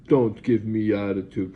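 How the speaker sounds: slow, loud, stern warning; stern scold. Retold or imitated. Nurse scolding patient, slow loud warning. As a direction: bark it out slowly like a scolding nurse. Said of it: "pointing his finger at my face".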